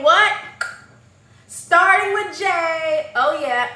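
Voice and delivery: loud, high, drawn-out reveal; milk the reveal theatrically; big incredulous call-out; dramatic exclamation. Acted. Mock-dramatic name reveal.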